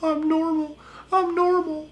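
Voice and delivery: very high mock sobbing rising; mock crying. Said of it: "a normal person wouldn't cry".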